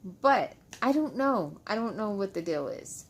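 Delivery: ordinary talk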